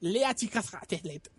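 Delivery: mangle it in a fake Israeli accent; rusty Israeli accent. Reading the Israeli dub to friends, rusty fake Israeli accent. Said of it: "en israelí, que también está oxidado".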